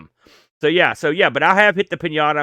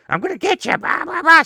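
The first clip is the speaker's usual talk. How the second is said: much higher, mock-menacing puppet voice; spooky puppet taunt; 12 semitones higher than usual. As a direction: tease a baby as a creepy sock puppet. Scaring baby brother with puppet.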